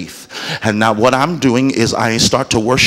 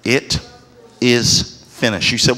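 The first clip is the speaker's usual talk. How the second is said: ordinary delivery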